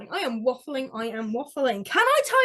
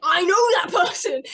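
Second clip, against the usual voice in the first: loud, high yell of recognition; mock crowd outburst; excited yell; 5 semitones higher, 7 dB louder than usual. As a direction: shout it like an overexcited fan. Imitating chat shouting in recognition.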